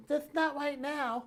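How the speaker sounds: put-on high, slow toddler answer; childlike, high and slow; little boy's voice. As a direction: draw it out high like a small child. Young son's answer acted out.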